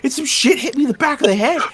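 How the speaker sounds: high-pitched tirade of disbelief; exasperated rant. Acted. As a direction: pile up grievances in outraged disbelief. Acted rant of bewildered suspect.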